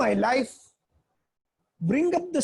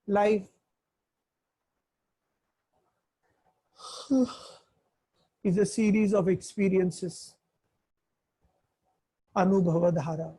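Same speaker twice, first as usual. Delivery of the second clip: low, drowsy voice with a heavy sigh; a tired lecturer fading into sleep; sleepy and dull; 5 semitones lower than usual. Shown as a demonstration. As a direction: drag it out like you're half asleep. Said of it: "I will talk like".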